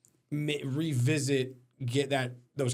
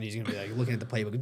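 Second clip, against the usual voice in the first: ordinary talk, nothing marked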